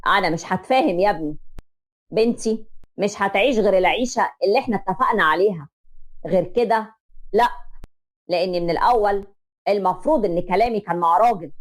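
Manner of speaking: a father standing his ground, measured; stern father. Acted. Father confronting the mother-in-law, low and firm.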